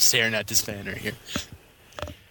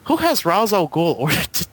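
after laughing hard; 7 semitones higher than usual. Real, not acted. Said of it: "I need to compose myself".

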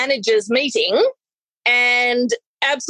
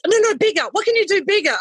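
eager push; 7 semitones higher, faster than usual. Retold or imitated. Manager pushing for more.

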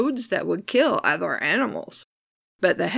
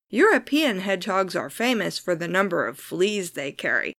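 ordinary talk, nothing marked

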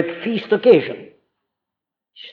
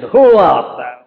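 scornful, mocking question, a bit louder; sarcastic derision; 4 dB louder, slower than usual. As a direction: sneer it down at a mere carpenter. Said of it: "They asked it in utter sarcasm and derision".

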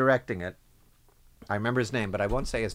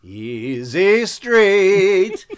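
very slow, loud drawn-out "Easy Street"; smug dream; 7 semitones higher, 8 dB louder, much slower than usual. Retold or imitated. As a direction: savour it like money is on the way.

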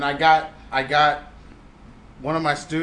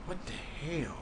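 quoting student; 18 dB quieter, slower than usual. Retold or imitated. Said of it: "She just said".

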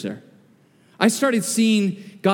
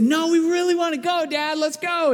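put-on kids pleading; 9 semitones higher than usual. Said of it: "But they said".

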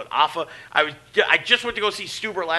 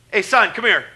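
louder, commanding call across the room; retold loud summons; 5 semitones higher, 9 dB louder than usual. Calling a disrespectful kid over in front of everyone, loud. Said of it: "mad loud".

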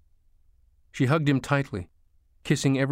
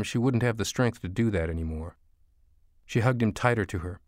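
ordinary delivery

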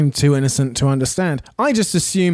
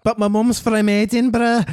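higher, put-on Edinburgh accent; mock Scottish accent; 6 semitones higher than usual. Co-host joining the accent game, hammed-up Scottish accent. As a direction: parody an Edinburgh Scot. Joke accent answering the Scouse one.

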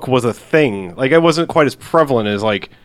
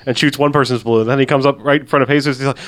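normal talking voice

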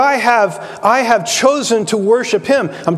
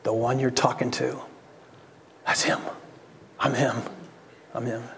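Jesus revealing himself, much softer and lower; hushed quiet; 9 semitones lower, 12 dB quieter than usual. Retold or imitated. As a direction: speak it softly, as a quiet revelation by the well. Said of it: "in the quietness of that moment".

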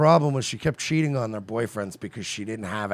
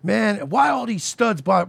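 incredulous quote; 12 semitones higher, 5 dB louder, slower than usual. Retold or imitated. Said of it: "The way he said it killed me".

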